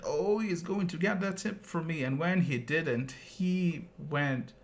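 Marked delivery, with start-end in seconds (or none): 0.0-2.0 mocking driver.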